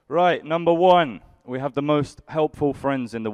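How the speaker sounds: big announcement. Real, not acted. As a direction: announce it grandly. Announcing first lesson.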